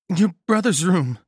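shivering, teeth chattering. A narrator's reading. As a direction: speak through chattering teeth. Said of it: "her teeth chattering".

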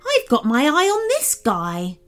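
a touch of an irritated bark. A narrator's reading. Unicorn watching the tiger, mildly barked. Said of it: "barked the unicorn".

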